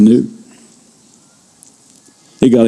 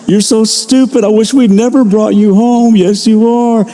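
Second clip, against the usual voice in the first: high, slow, sugary coo with cruel words; sweet baby talk to a dog; 10 semitones higher than usual. Shown as a demonstration. Pastor showing his dog a sweet tone, high cooing. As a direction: coo it sweetly like praising a pet. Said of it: "I can smile and sweetly say".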